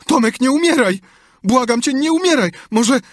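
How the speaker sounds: very high, loud plea; panicked begging. A narrator's reading. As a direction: cry out desperately to a dying brother. Sid begs brother not to die.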